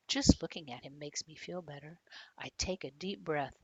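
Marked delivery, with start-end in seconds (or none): none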